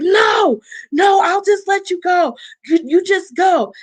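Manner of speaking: half-acted frantic backing down; panicked pleading. Imitating boyfriend begging her not to jump, panicked and high.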